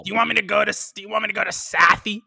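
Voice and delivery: exaggerated Boston townie accent; mock Boston accent. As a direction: badger them in broad Bostonian. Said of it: "He doesn't sound like he's from Boston at all".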